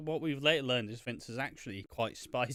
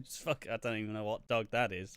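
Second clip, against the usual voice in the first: normal talking voice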